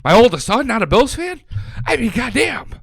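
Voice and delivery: high-pitched comic disbelief; incredulous, loud and high; mock outrage. Real, not acted. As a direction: exclaim it like a betrayed sports fan. Mock outrage at son's team choice.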